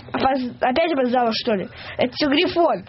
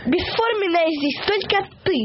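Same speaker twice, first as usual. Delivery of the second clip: plain reading voice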